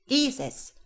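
loud, high, emphatic announcement; showy sorcerer's proclamation; triumphant wizard. A narrator's reading. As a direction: declare it with a flourish.

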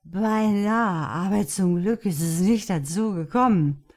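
grinning. Acted. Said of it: "erwiderte er grinsend".

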